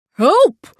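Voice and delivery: loud high cry for help; yell. Acted. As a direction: shout up from the tree. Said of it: "Rolly yelled".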